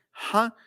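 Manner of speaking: "ja" as a breathy English H; demonstrated aspirate "ja"; H sound. Shown as a demonstration. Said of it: "fonema H, así".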